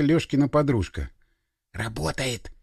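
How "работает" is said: hushed admiration; awed. A narrator's reading. Boy marvels that the summoning works, softly awed. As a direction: breathe it out softly, in wonder. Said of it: "восхищённо сказал Вадик".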